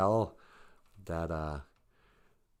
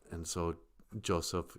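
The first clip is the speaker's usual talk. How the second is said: usual speaking voice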